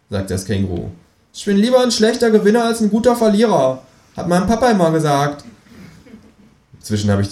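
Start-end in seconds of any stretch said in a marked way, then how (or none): 1.3-5.4 high, stubborn comeback; high-pitched sore-loser kangaroo; defiant kangaroo. Acted. Kangaroo figure retorts in story.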